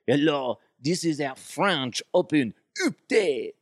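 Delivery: hammed-up French accent, high and drawn out; slow, comic French announcer; French Open host. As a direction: play up a silly French accent. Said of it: "I used to do French Open updates".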